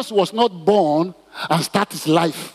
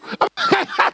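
amused laugh. Real, not acted. Mocking the scheming mother.

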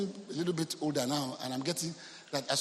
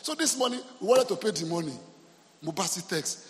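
usual speaking voice

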